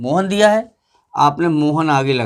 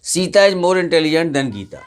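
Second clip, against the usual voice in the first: whole sentence read through; slower than usual. Shown as a demonstration. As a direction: read it in one smooth run at a moderate pace. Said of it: "कि सीता".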